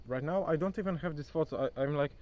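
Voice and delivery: ordinary talk, nothing marked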